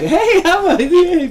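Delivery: put-on high, loud, slow childlike "Hey, how are you?"; mimicking the kids. Father mimicking his kids staring at him, high squeaky child voice. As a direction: squeal it like a startled little kid. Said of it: "kids are looking at me like".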